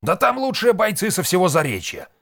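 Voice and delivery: high, loud warning; alarmed objection. A narrator's reading. Red warning about the best fighters, agitated. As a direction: protest in high-pitched alarm.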